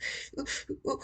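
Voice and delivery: breathless high gasp; startled gasp at the screen; sharp gasp. Real, not acted. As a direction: catch your breath in shock. Sudden gasp at chart.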